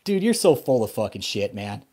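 ordinary delivery

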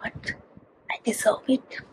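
soft unvoiced whisper; whispered boast. Real, not acted. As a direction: hush it playfully. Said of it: "I'm speaking very, very slow".

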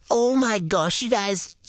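normal talking voice

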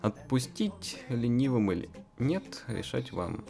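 usual speaking voice

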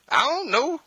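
high, whiny I don't know; mock sulky spouse. Preacher imitating an unpleasant spouse, high and whiny. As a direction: shrug it off like a pouting mate.

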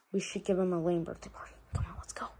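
fast unvoiced whisper, plotting in secret; sneaky whisper. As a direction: whisper the plan quickly so no one hears. Sneaky aside before joking.

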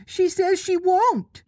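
put-on high, slow, shocked boyish voice; aghast Nibs. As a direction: gasp it out, scandalised. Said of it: "Nibs exclaimed, aghast".

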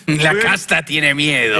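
slow, menacing demonic voice; slow it down and let the demon speak; diabolical voice. Acted. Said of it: "la voz diabólica".

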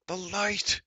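high, loud pained shriek; a giant shrieking, blinded by light. Acted. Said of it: "he shrieked".

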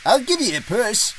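high-pitched, relieved offer of help; relieved Donald. A narrator's reading. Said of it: "Donald sighed with relief".

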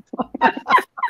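high, loud burst of laughter. Real, not acted. Laughing at her own fast talk, loud high burst. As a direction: break into a real laugh at herself.

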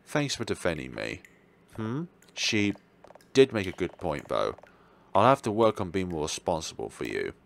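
usual speaking voice

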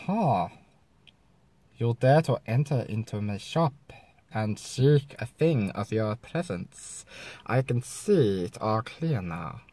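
low, soft mock Chinese accent; slow, hushed, put-on Chinese accent. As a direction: play an ominous shopkeeper with a Chinese accent. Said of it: "then we got China".

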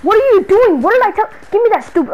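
loud, high angry questions; furious shout at the returning stalker; exasperated yell. Acted. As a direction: blow up at her again. Stalker appears again.

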